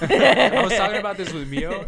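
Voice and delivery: laughing. Real, not acted. Laughing while telling.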